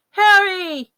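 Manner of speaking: high urgent call. Acted. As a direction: shout his name to wake him. Someone calls Harry awake.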